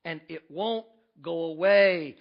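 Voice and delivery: ordinary talk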